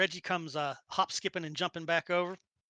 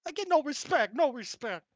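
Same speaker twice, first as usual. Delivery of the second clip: put-on high Brooklyn-accented griping; hammed-up, aggrieved Brooklyn voice; 5 semitones higher, 3 dB louder, faster than usual. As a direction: complain to the room in broad Brooklynese. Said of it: "I don't know why I'm Brooklyn again".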